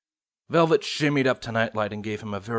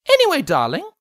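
high refined lady voice; higher, elegant pony lady; Rarity voice; 6 dB louder than usual. Acted.

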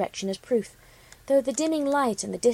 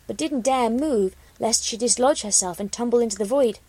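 usual speaking voice

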